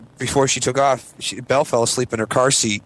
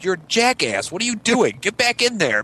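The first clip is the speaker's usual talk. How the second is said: self scolding; 7 semitones higher than usual. Retold or imitated. Scolding himself.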